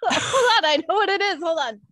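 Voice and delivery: high, loud rush of excitement, breaking into laughter; so thrilled it comes out high and laughing; excited outburst. Real, not acted. Co-host suddenly remembers the show she means, excited. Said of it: "I got so excited".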